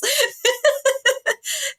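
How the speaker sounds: high, breathy burst of laughter; real laughter at the memory; giggling fit. Real, not acted. Retelling the funny mix-up.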